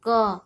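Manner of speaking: क isolated, its अ vowel clearly sounded; audible final अ. Shown as a demonstration. Teacher proving क needs a vowel, demonstrating the sound. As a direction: let the अ ring at the end of क. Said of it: "अ का उच्चारण हो रहा ना".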